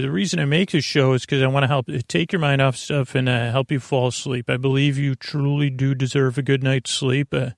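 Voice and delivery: normal talking voice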